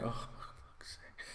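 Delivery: a whispered groan of disbelief; hushed exasperated curse. Retold or imitated. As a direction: breathe it out fed up, barely voiced. Exasperated reaction to naive fans.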